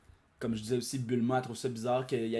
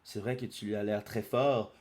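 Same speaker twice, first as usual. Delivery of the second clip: plain reading voice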